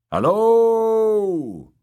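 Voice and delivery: loud high call into the ruins. A narrator's reading. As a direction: shout it out to find anyone. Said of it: "called Nimble Dick".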